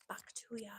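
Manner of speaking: breathy unvoiced ASMR whisper; soft ASMR whisper, no voice. Shown as a demonstration. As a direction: whisper it close to the mic.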